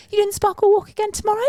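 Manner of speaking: high, fast bright question; chirpy shop assistant. Retold or imitated. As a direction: rattle it off like a shopkeeper.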